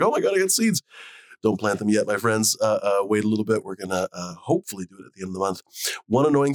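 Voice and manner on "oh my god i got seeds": excited. Retold or imitated. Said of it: "you're like".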